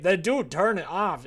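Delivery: loud, high exasperated shout at the game; frustrated yell. Real, not acted. Gamer shouting at the game about the late hour, loud. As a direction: yell it, fed up.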